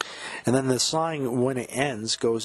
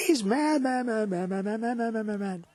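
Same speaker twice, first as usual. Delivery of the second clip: screamed mad; 12 semitones higher than usual. Retold or imitated. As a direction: shriek it like a madman, dying away.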